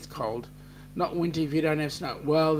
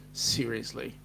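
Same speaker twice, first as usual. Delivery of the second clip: ordinary talk, nothing marked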